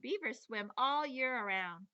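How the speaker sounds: ordinary delivery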